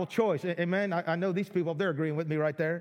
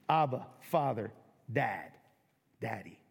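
intimate, fading tenderness; tender hush; 9 semitones lower, 4 dB quieter than usual. Real, not acted. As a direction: lower and softer with each word, fading to almost nothing. Softening the name for God.

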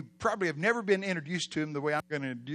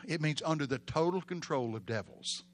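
ordinary talk

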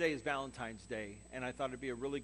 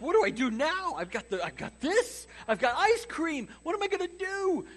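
high, loud, frantic worry; panicked; 13 semitones higher, 8 dB louder than usual. Retold or imitated. Nervous boyfriend panics with ice cream, high loud mock panic. Freaking out.

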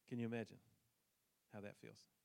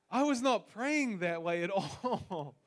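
high, loud, slow laughing remark; laughing emphasis; 8 semitones higher, 13 dB louder, slower than usual. Real, not acted. Pastor laughing at himself to congregation, loud and high. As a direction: push it out through a laugh. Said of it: "laughing comment on his prayer".

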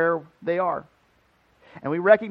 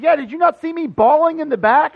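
amazed; 9 semitones higher, 9 dB louder than usual. Retold or imitated. Terrell quoted, amazed.

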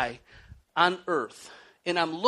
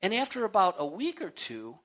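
usual speaking voice

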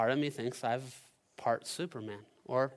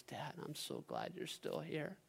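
put-on soft, higher, sad child voice; Easton; 5 semitones higher, 9 dB quieter than usual. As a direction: imitate a tearfully grateful boy, very quietly. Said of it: "he's just got this sad face".

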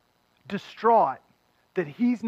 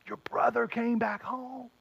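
whisper; 3 semitones higher, 7 dB quieter than usual. Retold or imitated. Servants whisper news to brother.

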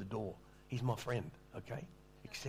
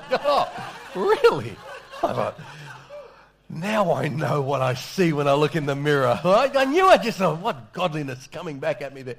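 loud, high-pitched comic incredulity; mock disbelief; 6 semitones higher, 15 dB louder than usual. Preacher telling the congregation of being called godly, loud mock disbelief. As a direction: cry out in exaggerated self-doubt.

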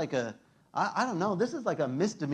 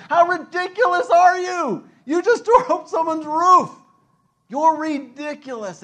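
mock scornful yell; 12 semitones higher, 12 dB louder than usual. Onlookers scolding the friends, loud and high. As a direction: shout down the roof breakers.

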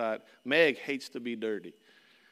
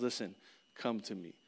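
put-on soft and much quieter, like calling a child close; tender invitation; 5 semitones lower, 9 dB quieter than usual. Pastor softly voicing Jesus's call to the congregation, tender. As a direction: gently coax them in.

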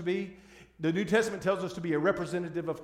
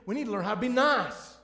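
nice said in a broad Southern drawl, louder and higher; 7 semitones higher, 6 dB louder than usual. Shown as a demonstration. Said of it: "I said that with a southern drawl on purpose".

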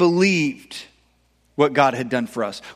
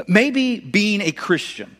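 slow emphasis; 4 semitones higher, much slower than usual. Shown as a demonstration. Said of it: "preacher repeats the phrase for weight".

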